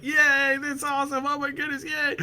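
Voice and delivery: put-on high squealing kids cheering; excited kids. Kids in the car hearing about ice cream, squealing high. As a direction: cheer like thrilled children. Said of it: "everybody's like".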